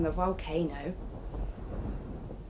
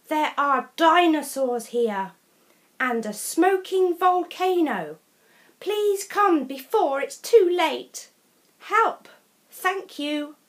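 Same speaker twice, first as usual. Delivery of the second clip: put-on high, loud pleading girl voice; a stranded girl begging for rescue; Katie's message; 8 semitones higher, 5 dB louder than usual. A narrator's reading. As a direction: read it as a frantic girl calling for help.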